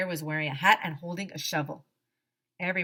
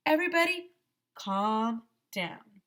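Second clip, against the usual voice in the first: bear calming; 4 semitones higher than usual. Acted. Bear calms class.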